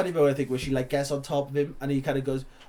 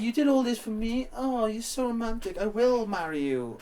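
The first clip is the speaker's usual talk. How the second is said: higher, slow lovestruck gush; mock romantic gushing; 7 semitones higher, 3 dB quieter than usual. Girlfriend swoons and agrees to marry, mock romantic. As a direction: swoon over him, overdone. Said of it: "she's just like".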